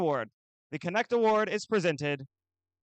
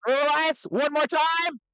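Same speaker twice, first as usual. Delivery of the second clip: put-on broad singsong kids theatre. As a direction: pump up the crowd like an emcee. Event announcer hyping the crowd.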